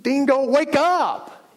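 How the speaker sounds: high, loud, very slow call; a divine nudge to wake up; slow insistent wake-up call; God waking him. Retold or imitated. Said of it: "God had to go".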